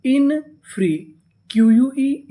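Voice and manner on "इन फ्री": "इन फ्री" slowly in two parts, raised pitch; syllable by syllable. Shown as a demonstration. Teacher builds the word for learners, "इन फ्री" slow and separated. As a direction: draw out each part of "इन फ्री". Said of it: "इतनी meaning क्या बनी".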